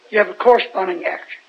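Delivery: normal talking voice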